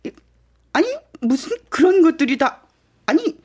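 rising, stammering disbelief; flustered disbelief. Acted. Adviser flustered by bad news.

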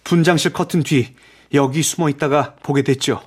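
high-pitched earnest witness; Raoul, agitated. A narrator's reading. Narrator voices agitated young Raoul.

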